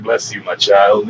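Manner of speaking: low, slow put-on priest voice; mock priest blessing. Fake priest sends off old man, mock-solemn. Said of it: "had to take fucking lessons from the guy that was giving me his confession".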